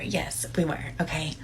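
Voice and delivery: whisper. Real, not acted. Sheepish aside about shared shower.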